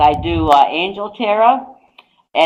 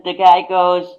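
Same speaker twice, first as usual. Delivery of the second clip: ordinary talk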